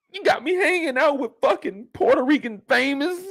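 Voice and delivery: high, accusing rant; flipping-out teen son. Acted. Kanan blaming his mother for dead friends, high and upset. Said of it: "Kanan is just flipping out".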